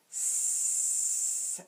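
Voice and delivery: "S-ad" drawn out sound by sound; segmented slowly. Shown as a demonstration. Teacher says "sad" slowly together with students. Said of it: "we'll say it together with them".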